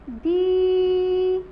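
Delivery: दीपक slowly, दी held very long, then पा, क apart; long stressed दी. Shown as a demonstration. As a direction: sustain दी, pause, then break off पक. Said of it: "लंबा खींचना है और जोर देकर बोलना है". (दीपक में दी बहुत लंबी खींचकर)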